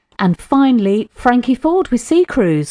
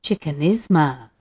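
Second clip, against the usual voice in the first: low, soft Spanish accent; 7 semitones lower, 5 dB quieter than usual. Shown as a demonstration. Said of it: "Say it in a Spanish accent then".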